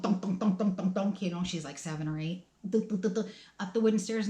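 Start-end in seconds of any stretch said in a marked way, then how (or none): 0.0-1.2 rhythmic mock footsteps thudding; imitated stair thunks. Mother imitating her daughter stomping up the wooden stairs. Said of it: "you'd hear thunk". 2.7-3.3 rhythmic mock footsteps thudding; imitated stair thunks. Mother imitating her daughter stomping up the wooden stairs. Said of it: "you'd hear thunk".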